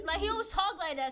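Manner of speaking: ordinary talk, nothing marked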